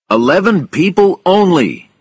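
loud, high announcement to a crowd; calling out orders. A narrator's reading. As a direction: lay down the limit loudly. Pilot limits a crowd of passengers.

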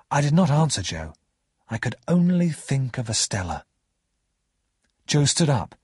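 ordinary delivery

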